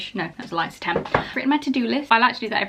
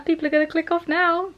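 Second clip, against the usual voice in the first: high-pitched self-mocking fretting; mock worry; 8 semitones higher than usual. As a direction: fret in a raised, put-on voice. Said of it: "that I'm like".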